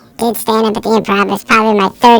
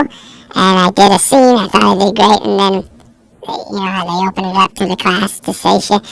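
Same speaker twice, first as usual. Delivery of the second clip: ordinary talk, nothing marked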